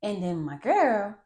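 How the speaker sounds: ordinary delivery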